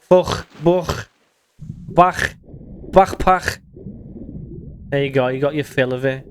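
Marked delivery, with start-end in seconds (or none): 0.1-3.6 "Fuch", "Buch", "Bach" with a rasping throaty ch; guttural ch sounds. Shown as a demonstration. Streamer showing off the ch sound to chat, rasping throat. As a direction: scrape the ch in "Bach" at the back of the throat. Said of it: "The ch-, ch sound is nice".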